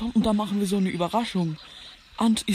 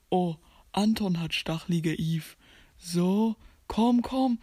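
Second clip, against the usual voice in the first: ordinary talk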